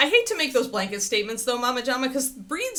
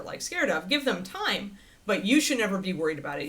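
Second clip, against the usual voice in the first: ordinary talk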